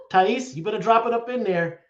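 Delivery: normal talking voice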